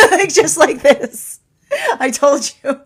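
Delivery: slow, high, loud laughing line. Shown as a demonstration. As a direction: giggle the words out slowly. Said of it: "I hear the higher self going".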